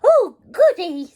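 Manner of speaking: high, loud delighted granny. A narrator's reading. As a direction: squeal with glee. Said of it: "said Granny".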